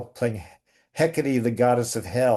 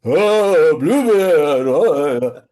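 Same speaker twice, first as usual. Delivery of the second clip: loud, high, drawn-out theatrical cry; much bigger; 4 semitones higher, 8 dB louder, much slower than usual. Retold or imitated. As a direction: go huge and operatic on the name. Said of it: "I just did really, like".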